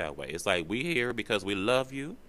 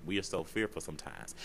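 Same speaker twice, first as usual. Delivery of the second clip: ordinary delivery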